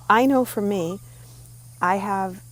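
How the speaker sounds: usual speaking voice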